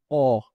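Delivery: "O" with an open mouth; open o demo. Shown as a demonstration. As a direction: shape "A" but say "O". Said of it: "En vez de decir A, dicen O". ("O" abierta, boca como "A")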